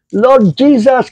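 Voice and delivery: slow, lifted prayerful call; calling on the Lord. Shown as a demonstration. Preacher showing how to call upon the Lord, slow prayer. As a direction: draw out Lord Jesus slowly and high. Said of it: "you call upon the name of the Lord".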